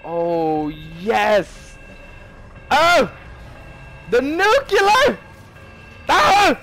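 high, loud victory shouting; triumphant yelling. Real, not acted. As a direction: scream in triumph. Nuke goes off.

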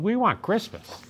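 high, pleading troll wail. A narrator's reading. Trolls begging the child, high wail. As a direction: whine it like a longing troll. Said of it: "they wailed".

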